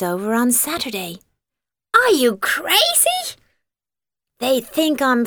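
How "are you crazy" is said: high, incredulous squeal; can't believe what she's hearing; a startled teen's outburst; shocked disbelief. Acted. Girl shocked at mother's idea.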